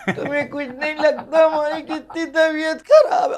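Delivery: high, plaintive complaining wife; whiny wife. Acted. Wife scolds husband for not caring, high and whiny. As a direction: whine for sympathy.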